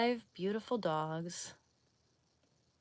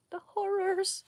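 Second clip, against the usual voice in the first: much higher, louder hammed-up wail; shrill mock horror; 13 semitones higher, 6 dB louder than usual. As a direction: squeal it like a cartoon victim. Escalated mock repeat.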